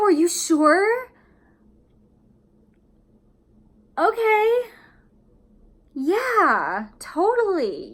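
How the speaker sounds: high, loud, slow, strained enthusiasm; fake delight. Shown as a demonstration. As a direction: force a thrilled yes you don't feel. Forced joy at maid of honor.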